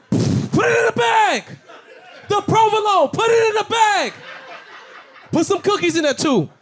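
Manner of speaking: very high, loud holdup yelling; hammed-up robbery yell; robber shout. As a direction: bark orders like a stickup.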